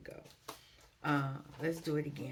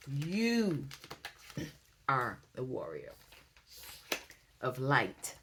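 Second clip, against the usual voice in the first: slow emphatic affirmation; 2 semitones lower, slower than usual. Real, not acted. Reader telling listeners they are warriors, slow emphatic affirmation. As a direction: let each phrase land separately.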